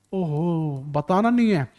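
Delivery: a quiet conspiratorial aside; mock hush. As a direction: play a sneaky student keeping the secret. Teacher voices a hushed student.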